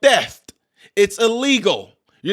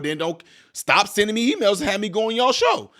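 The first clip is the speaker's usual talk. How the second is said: ordinary delivery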